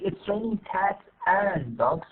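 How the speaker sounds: AND pronounced fully, slow and separate; long form. Shown as a demonstration. Teacher shows what not to say, slow full AND. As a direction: stretch out AND with its full vowel. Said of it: "Now, instead of saying".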